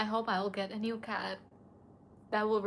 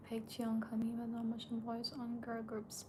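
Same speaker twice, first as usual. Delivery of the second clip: soft unvoiced whisper; hushed aside to chat; 11 dB quieter than usual. Real, not acted. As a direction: whisper it under your breath. Whispering names on stream.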